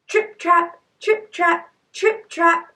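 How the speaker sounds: high, rhythmic trip-trap; hoofstep sound effect. A narrator's reading. Goat's hooves crossing the bridge.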